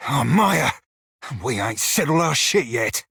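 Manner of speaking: normal talking voice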